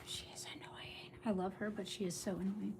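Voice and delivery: hushed whispered complaint; under the breath, fed up; exasperated whisper. Real, not acted. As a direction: whisper it like a confession of annoyance. Confiding about the whining dog.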